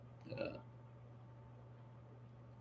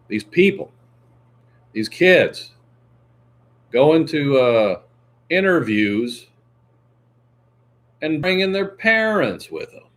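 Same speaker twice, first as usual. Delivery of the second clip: imitating Kevin O'Leary; 6 semitones higher, 5 dB louder than usual. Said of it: "Kevin O'Leary, he said".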